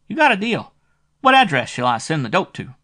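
a small-time dick squeaking out his price; shrill private eye. A narrator's reading. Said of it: "This dick had a shrill voice".

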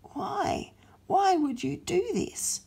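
baffled Satan. Acted. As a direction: ask in bewilderment. Satan asks why.

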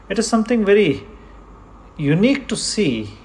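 ordinary talk, nothing marked

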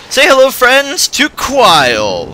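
high, drawn-out cartoonish announcement; hammed-up gnome character. Gnome greeting travellers on the road, hammed-up character voice.

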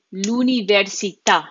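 l'università with the last à punched; final stress on l'università. Shown as a demonstration. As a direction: save the weight for the final syllable of l'università. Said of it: "this is where the emphasis goes".

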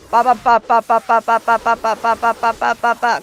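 loud chanted combo beats; rhythmic mock hits. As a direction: beat out each strike with your voice. Combo attack sound effects.

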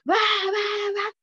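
high, tearful sobbing syllables; child's choked crying sounds; imitating a crying child. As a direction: mimic a sobbing little girl gasping out va va. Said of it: "solamente decía".

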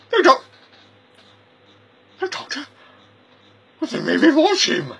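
a dithering little man catching on; high-pitched bumbling stranger; high squeaky visitor. Acted. Visitor realizes who it is.

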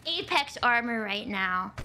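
usual speaking voice

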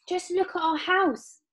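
high, dismayed outcry; Mister Bucket crying out. A narrator's reading. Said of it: "cried poor Mister Bucket".

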